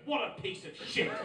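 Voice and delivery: loud, high mock disgust. Forty-year-old judging art in galleries, loudly contemptuous. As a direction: announce your contempt to the whole gallery. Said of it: "out loud".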